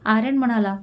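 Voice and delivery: ordinary delivery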